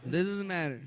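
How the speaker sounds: ordinary delivery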